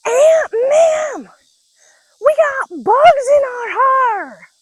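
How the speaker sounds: put-on very high, loud, slow child's announcement; proudly broadcast it like an oblivious five-year-old; shouting across the store to a family friend, high and loud; little girl shouting. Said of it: "Deb goes".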